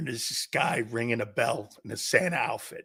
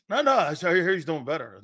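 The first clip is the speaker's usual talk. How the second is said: usual speaking voice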